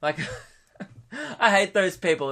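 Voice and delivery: ordinary talk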